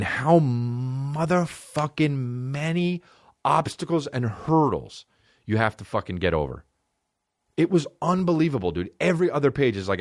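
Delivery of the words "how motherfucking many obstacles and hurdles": slowed for emphasis. Real, not acted. Emphasising how many hurdles.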